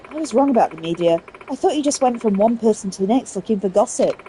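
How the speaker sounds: ordinary talk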